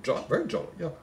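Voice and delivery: usual speaking voice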